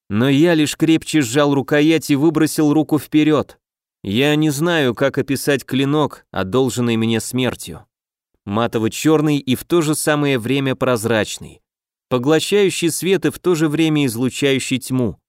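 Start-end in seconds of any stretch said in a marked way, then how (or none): none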